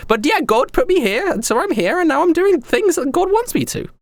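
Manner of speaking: very high, gushing and excited emperor voice; giddy young emperor. Acted. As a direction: squeal each greeting like a flustered young ruler. Same emperor voice.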